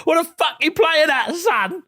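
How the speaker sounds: hammed-up East End fury; mock soap shout. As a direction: bellow like a soap hardman. Mock EastEnders row.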